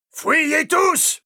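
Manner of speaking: very high, strained yell. A narrator's reading. Prior telling all the monks to flee, yelling. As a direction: scream the order in panic. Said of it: "hurla Ulfric".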